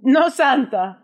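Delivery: a light put-on Israeli accent; mock Israeli dad accent. Teasing Wheezy by voicing her Israeli father, light accent.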